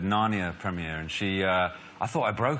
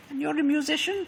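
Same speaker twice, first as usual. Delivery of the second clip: the Queen. Retold or imitated. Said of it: "she said".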